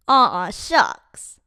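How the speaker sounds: plain reading voice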